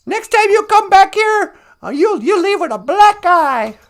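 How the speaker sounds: loud, high-pitched threat; angry chicken. Acted. Chicken warning Wagosh the fox off, loud angry threat. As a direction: threaten loudly like a mad hen. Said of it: "You could hear the chicken saying".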